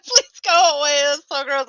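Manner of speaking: embarrassed laugh-shout; laughing plea. Retold or imitated. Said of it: "I was like".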